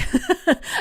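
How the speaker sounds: short, rising chuckle; small high chuckle; light laugh. Real, not acted. Laughing at her confusion.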